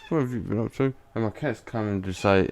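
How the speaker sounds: ordinary talk, nothing marked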